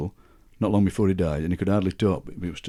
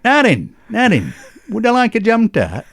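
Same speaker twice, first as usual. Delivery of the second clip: much higher, louder Sheffield uncle; Uncle Jack voice; 11 semitones higher, 5 dB louder than usual. Retold or imitated. Uncle offering the trick jam tart, high Sheffield voice. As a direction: ham up the joking uncle. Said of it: "And he went".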